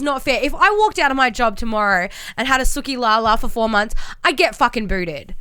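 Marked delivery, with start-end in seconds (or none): none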